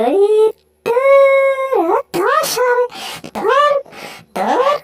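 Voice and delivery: high, excited cartoon mouse, squealing and fussing; squeaky mouse voice. Mouse finding food then scolding the sleepy cat, high squeaky voice. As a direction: play a tiny panicky mouse, high up.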